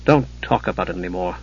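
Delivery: low, subdued plea; understated shudder, dropping low and soft. A narrator's reading. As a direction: say it softly, with a touch of dread. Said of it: "Palin shuddered".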